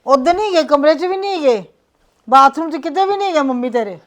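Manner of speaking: frantic worried aunt; panicked. Acted. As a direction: blurt it out in a panic. Panicked report of missing mother.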